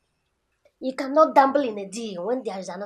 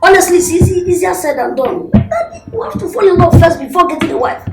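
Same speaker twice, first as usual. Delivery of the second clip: loud, higher-pitched objection; raised-voice protest; 7 semitones higher, 10 dB louder than usual. Acted. Son arguing with his father about marriage, loud protest. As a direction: protest loudly to your father.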